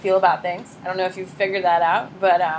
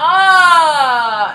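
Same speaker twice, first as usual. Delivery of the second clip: startled cry; 7 semitones higher, 8 dB louder than usual. Pretend.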